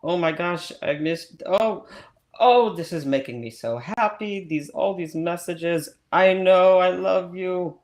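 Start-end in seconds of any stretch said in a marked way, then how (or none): none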